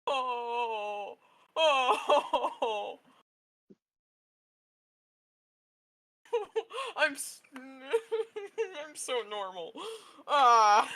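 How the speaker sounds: loud, high laugh breaking into tears; sob-laugh, completely overcome; laughing and crying. Real, not acted. Player breaking down as the character is called sweetheart, laugh-crying loudly. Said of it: "breaks down".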